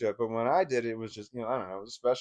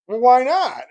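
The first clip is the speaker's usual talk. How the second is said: loud, high indignant retort; mock outraged questioning; demanding; 11 semitones higher, 11 dB louder, slower than usual. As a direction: imitate an irate ex demanding an answer. Ex-wife demanding answer, retold.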